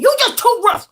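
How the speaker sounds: high, mock-stern scolding. Scolding the heavy dog for knocking her down, mock-stern high voice. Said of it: "I'm like".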